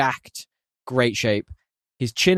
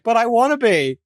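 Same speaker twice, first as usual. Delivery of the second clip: whiny hero; 9 semitones higher, 4 dB louder than usual. Pretend. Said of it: "He's like".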